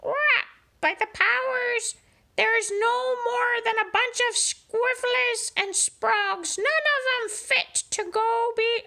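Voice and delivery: very high squawking parrot rant; parrot pirate. Acted. Parrot voice held.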